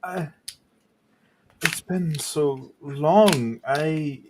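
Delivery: a touch of weeping disbelief; grieving widower, mildly tearful; mild acted tearful. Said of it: "Tears immediately just start falling".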